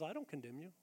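soft, gentle reassurance; tender. Shown as a demonstration. Jesus speaking softly to broken people, tender. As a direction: say it gently, like comforting someone. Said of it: "hear the voice of Jesus".

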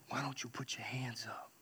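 soft unvoiced whisper, leaning in close; whispered nudge. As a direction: whisper it so only he hears. Wife leans over during worship.

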